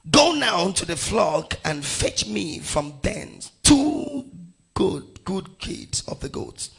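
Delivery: emphatic, measured Bible reading; scripture reading. Acted. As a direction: proclaim the verse phrase by phrase. Reads scripture aloud to congregation.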